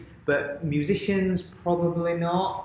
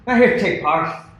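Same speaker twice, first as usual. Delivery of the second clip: put-on terrible Irish accent; 6 semitones higher, 9 dB louder than usual.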